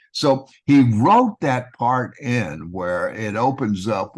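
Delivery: ordinary talk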